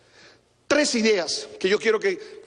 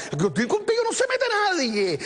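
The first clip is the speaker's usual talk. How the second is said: much higher, swaggering boast; cocky fighting rooster; 12 semitones higher than usual. Retold or imitated. Preacher mocking Peter's promise to Jesus, swaggering high boast. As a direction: crow it like a strutting gamecock. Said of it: "sonó como gallito de pelea".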